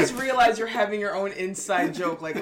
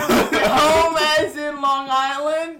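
laughing; 4 semitones higher, 8 dB louder, much slower than usual. Real, not acted. Laughing embarrassed guess.

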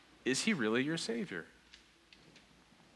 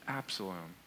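very low, hushed lament; grieving father; 9 semitones lower, 13 dB quieter than usual. Retold or imitated. Preacher repeats David's cry for Absalom, hushed grief. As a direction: sink into quiet, retold mourning. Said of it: "David is crying over his son".

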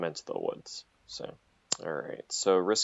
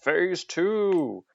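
yelled call; 10 semitones higher than usual. Acted. Player voicing his character's rallying call to the gang, yelled. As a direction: call it out high, stretching TWO. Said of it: "I yell out".

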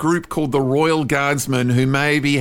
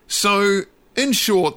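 usual speaking voice

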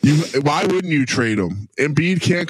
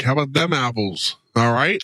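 usual speaking voice

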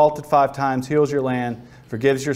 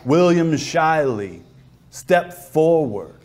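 God summoning; 2 semitones higher, much slower than usual. Acted. God calls William out from the crowd, slow solemn summons. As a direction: call him forward slowly, with authority. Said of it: "God stands up on the cliff and he says".

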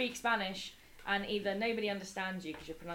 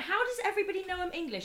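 exasperated question; 13 semitones higher, 5 dB louder, faster than usual. Retold or imitated.

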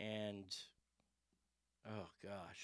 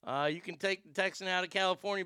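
ordinary talk

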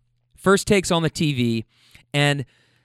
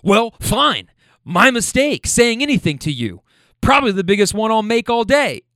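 retold offended huff, higher and louder; hostile huff; 5 semitones higher, 5 dB louder than usual. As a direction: play the butthurt guy snapping back. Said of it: "more hostile in tone".

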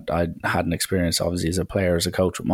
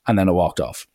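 normal talking voice

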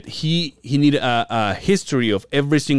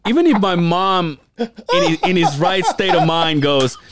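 incredulous protest to dad; exasperated outburst; 7 semitones higher than usual. Retold or imitated. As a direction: let the frustration spill over at dad.

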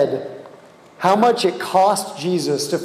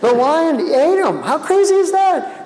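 mock disbelief; 8 semitones higher than usual. Mocking the lion people.

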